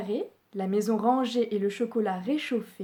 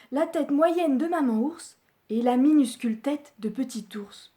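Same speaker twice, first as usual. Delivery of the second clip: plain reading voice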